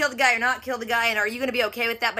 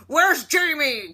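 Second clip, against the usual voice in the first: slow mock interrogation; 5 semitones higher, 4 dB louder, much slower than usual. Jenny interrogating the captive courier, slow menacing demand. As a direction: drag out each word, higher and louder, like a menacing interrogator.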